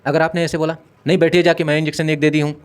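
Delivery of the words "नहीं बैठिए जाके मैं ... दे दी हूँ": louder, higher snapping brush-off; a curt nurse snapping at a patient's relative; rude curt reply. Shown as a demonstration. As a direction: bark it dismissively. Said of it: "उस तरीके से बोलोगे तो उसको भी गुस्सा आएगा".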